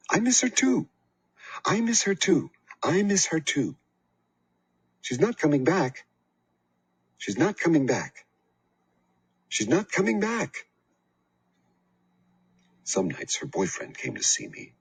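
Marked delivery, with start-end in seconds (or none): 0.1-10.6 put-on aunt yelling at the crying child; angry aunt. A narrator's reading. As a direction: yell the grief back at the child. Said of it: "screamed at me".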